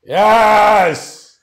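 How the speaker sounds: high, loud yells of joy; excited cheering. Real, not acted. Host cheering his big matchup reveal, loud excited yells. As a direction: whoop it up. Celebrating his own announcement.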